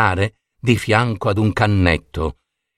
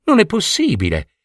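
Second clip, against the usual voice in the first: higher-pitched princess protesting the news; distressed disbelief; 7 semitones higher than usual. Acted. Princess refuses to believe the gnome. (incredula, voce più acuta)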